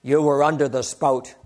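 a hint of a Polish preacher, deliberately paced; Polish accent. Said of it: "he looks at me in his Polish accent".

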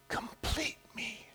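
soft, high, slow tearful plea; mock tearful movie line; a husband pleading tearfully with his wife.

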